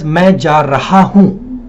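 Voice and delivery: "Main ja raha hoon" slowly, word by word; spell-it-out reading of "Main ja raha hoon", each word separate; pronounced as written. Shown as a demonstration. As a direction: give "raha hoon" every syllable and both h sounds. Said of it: "it is written as".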